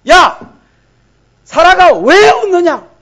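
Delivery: loud, high, drawn-out challenge; acted divine scolding at full voice; stern rebuke. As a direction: call her out sharply for laughing. God voiced rebuking Sarah's laughter.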